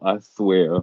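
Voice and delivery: ordinary talk, nothing marked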